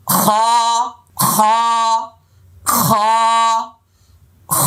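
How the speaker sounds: খ rasped from the throat like grating coconut; heavy kha. Shown as a demonstration. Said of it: "এইভাবে একটু চাষা চাষা ভাব থাকবে".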